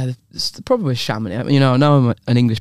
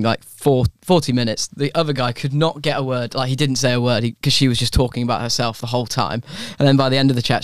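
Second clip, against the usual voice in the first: ordinary talk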